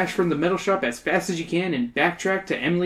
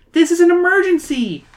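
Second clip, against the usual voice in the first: high, loud, urgent plea; pleading; 6 dB louder than usual. Acted. Emily telling her teacher it's urgent, pleading loudly. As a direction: insist at the top of the range. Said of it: "Emily pleads".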